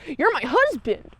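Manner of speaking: high, put-on old lady's plea; pleading old wife. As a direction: beg him like a wronged old wife. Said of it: "she's like".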